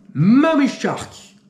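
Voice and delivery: slow, high trailer-voice announcement of "Mummy Shark"; dramatic announcement. Acted. Presenting the next shark film to viewers, dramatic trailer voice. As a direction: drag out "Mummy Shark" like a horror-movie trailer.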